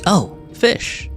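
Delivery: ordinary talk, nothing marked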